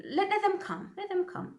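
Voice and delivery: high, daring "let them come"; defiant dare. Real, not acted. Vlogger telling viewers to dare the threat, high and defiant. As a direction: dare them, lifting your voice.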